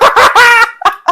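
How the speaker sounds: shrieking burst of laughter; high cackling laugh; loud laugh. Real, not acted. Teasing friend about pee.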